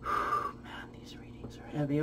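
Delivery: a weary aside, whispered; tired whisper. Real, not acted. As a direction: whisper it to yourself, worn out. Sighing over heavy readings.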